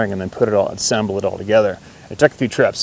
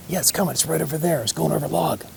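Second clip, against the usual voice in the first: hushed, higher-pitched insistence; urgent whisper; 6 semitones higher, 6 dB quieter than usual. Retold or imitated. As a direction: keep whispering, pressing the point. Kid insists quietly about beaver.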